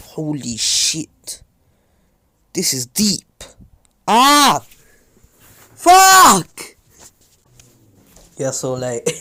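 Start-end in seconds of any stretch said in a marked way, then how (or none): 0.1-6.8 real pained yell, rising to a drawn-out high shout; real pained cursing. Real, not acted. Speaker finds he has cut himself deeply, real pained shouting. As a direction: curse louder and higher as the pain hits.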